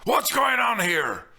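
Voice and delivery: loud, high demanding call; authoritative shouted question; intruding shout. A narrator's reading. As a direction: break in on them loudly. Priest intrudes on quarrel.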